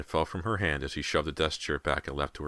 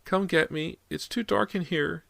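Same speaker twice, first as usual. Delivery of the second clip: put-on high, small scared little boy; softer, frightened child; 14 semitones higher than usual. A narrator's reading. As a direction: plead softly like a terrified child. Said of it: "sounded even softer now, more frightened".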